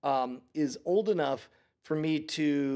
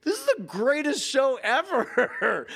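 much higher and louder gleeful cry; imitated teen's giddy rave; retold delighted cry; 11 semitones higher, 5 dB louder than usual. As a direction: mimic a laughing kid's thrilled verdict. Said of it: "she burst out laughing".

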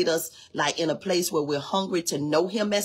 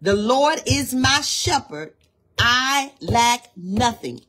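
louder and higher, slow and declaring; proclaimed; 6 semitones higher, 5 dB louder, slower than usual. Shown as a demonstration. Preacher declares the statement to settle all arguments, loud and slow. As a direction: proclaim it like a creed. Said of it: "by this one statement".

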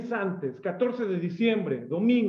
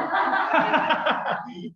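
amused laugh; 8 semitones higher than usual. Real, not acted. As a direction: laugh at your own joke. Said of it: "solo quería hacerle ese jocoso". (carcajada aguda y divertida)

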